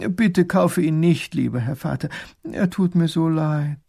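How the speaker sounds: pleading. Acted. Princess begging her father for the slave, pleading. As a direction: soften and beg.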